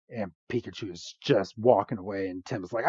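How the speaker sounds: ordinary talk, nothing marked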